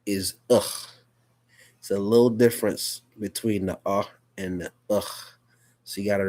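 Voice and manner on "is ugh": U said as a short ugh; short vowel U, ugh. Shown as a demonstration. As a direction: say U as ugh, like in cup. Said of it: "The short sound for the vowel U".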